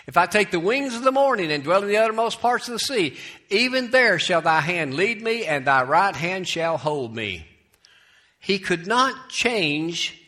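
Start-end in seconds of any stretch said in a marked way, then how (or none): 0.1-7.5 raised, ringing verse reading; scripture reading. Acted. Preacher reads David's psalm to congregation, declaimed scripture.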